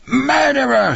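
very high-pitched acted scream; screaming accusation. A narrator's reading. Wife accusing husband over their boy, screaming. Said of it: "he heard her screaming".

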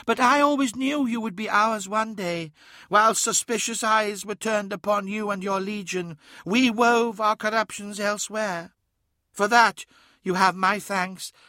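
high-pitched daemon voice with fatherly fondness; paternal affection. A narrator's reading. Reflection thanks Magnus for his service, fondly paternal, high. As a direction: gloat fondly, like a proud otherworldly father. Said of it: "The reflection smiled with paternal affection".

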